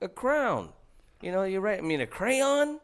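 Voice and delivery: a hint of the sheepish wife; high mock wife. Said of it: "she's like".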